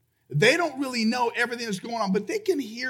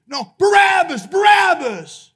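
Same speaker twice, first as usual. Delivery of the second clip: high, loud refusal from a mob; crowd shouting; 8 semitones higher, 10 dB louder, slower than usual. Retold or imitated. Crowd rejecting Pilate's offer to release Jesus, loud shout.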